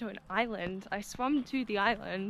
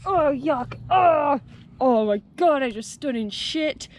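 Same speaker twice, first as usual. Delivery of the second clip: disgusted; 4 semitones higher, 11 dB louder, slower than usual. Real, not acted. Stepped in something disgusting.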